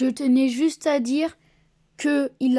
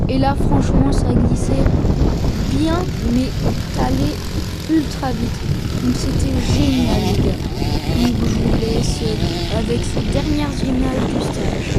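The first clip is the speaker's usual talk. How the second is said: loud voice over wind noise; shouting over wind; 5 dB louder than usual. Real, not acted. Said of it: "désolé".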